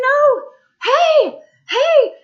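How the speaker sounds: high, loud retold yell. Mom stopping son heading to the kitchen, high yell. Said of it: "I'm still yelling".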